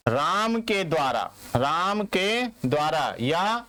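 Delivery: very slow, deliberate dictation; slow, word-by-word dictating voice. Shown as a demonstration. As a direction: spell it out slowly for the class. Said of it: "teacher dictating example".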